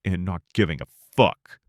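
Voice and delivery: ordinary delivery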